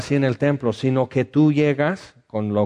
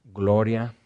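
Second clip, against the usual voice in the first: earnest pastoral prayer. Acted. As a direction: pray gently over the sad. Leads prayer for the sad.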